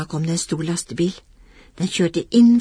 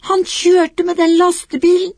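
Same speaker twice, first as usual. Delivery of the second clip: put-on upset child; 10 semitones higher, 6 dB louder than usual. Lillebror upset telling the shopkeeper.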